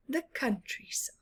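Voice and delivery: soft unvoiced whisper. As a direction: hush it. Whispered quiet place.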